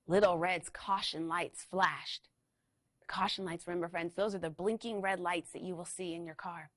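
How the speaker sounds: ordinary delivery, nothing marked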